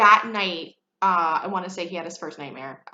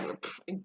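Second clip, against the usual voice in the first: scoffing breath; 12 dB quieter than usual. Retold or imitated. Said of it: "I was like".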